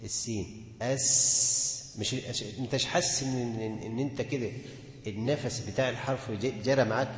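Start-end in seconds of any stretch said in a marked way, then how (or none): 0.8-2.0 أس stretched as a long hiss of breath; long voiceless s. Shown as a demonstration. Teacher demonstrating the breathy s sound. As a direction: hold the hiss on أس. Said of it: "هتيجي تنطق مثلًا الفاء".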